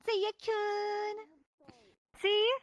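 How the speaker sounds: cutesy squeal. Pretend. Teasing after the transformation.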